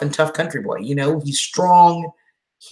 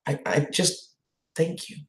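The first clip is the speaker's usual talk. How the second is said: choked up; 9 dB quieter than usual. Real, not acted. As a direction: voice fading to almost nothing, emotional. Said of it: "I'm gonna get all emotional again".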